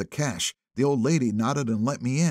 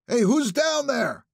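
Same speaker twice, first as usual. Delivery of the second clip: calling out; 13 semitones higher, 4 dB louder, slower than usual. A narrator's reading. Cop calls down.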